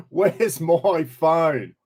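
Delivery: very slow, stretched-out question; playfully drawn out. Real, not acted. Streamer looking for a lost phone mid-greetings, playfully drawn out. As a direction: drag out every word while hunting around. Hunting for his phone, joking.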